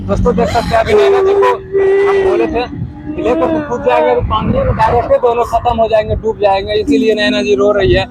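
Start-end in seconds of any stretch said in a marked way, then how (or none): 0.1-4.1 excited. Real, not acted. Excited address to viewers.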